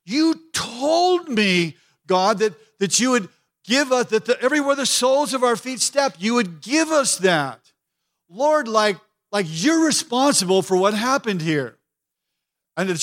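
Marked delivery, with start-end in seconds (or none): 0.0-11.8 anguished Joshua. Retold or imitated. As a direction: cry out to God as a shaken Joshua, stretching the words. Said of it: "He's like".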